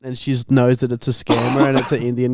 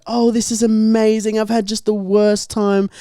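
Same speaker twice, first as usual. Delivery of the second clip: put-on ditzy girl voice; 7 semitones higher than usual. Mimicking the girl teasing the scammer, high and bubbly. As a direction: gush like a gullible girl bragging. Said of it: "then she's like".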